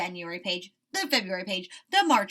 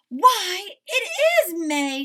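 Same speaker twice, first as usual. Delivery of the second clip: high, loud and slow wonder; amazed Toad; 10 semitones higher, 13 dB louder, much slower than usual. A narrator's reading. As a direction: gasp in delight.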